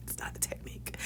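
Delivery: unvoiced whisper to herself; conspiratorial aside. Real, not acted. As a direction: drop to a secret whisper. Joking about her own technique.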